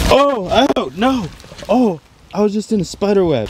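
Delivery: real fright, voice jumping high; shrieking after walking into a web; spider web panic. Real, not acted.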